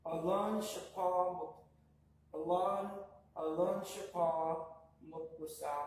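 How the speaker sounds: teacher sounding out Alon shapa piece by piece; syllable by syllable. Shown as a demonstration. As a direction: sound out each syllable carefully. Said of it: "we're gonna sound this out by syllable".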